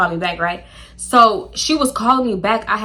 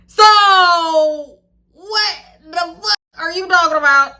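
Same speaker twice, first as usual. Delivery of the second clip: mock outrage; 8 semitones higher, 5 dB louder than usual. Real, not acted.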